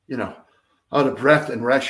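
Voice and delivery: normal talking voice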